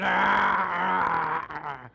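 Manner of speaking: long, loud imitated monster roar; sudden booming "Aaah" of a movie monster. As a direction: let out a drawn-out Frankenstein roar. Imitates Frankenstein's roar mid-story.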